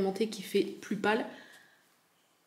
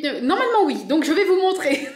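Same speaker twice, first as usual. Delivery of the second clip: higher and louder, laughing through the words; 7 semitones higher, 3 dB louder than usual. Real, not acted. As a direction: giggle through it as you speak. Laughs while counting viewers.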